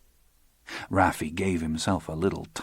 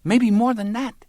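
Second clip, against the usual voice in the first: put-on much higher, complaining young woman's voice; aggrieved young woman; 4 dB louder than usual. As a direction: whine at him in broken English, wounded pride.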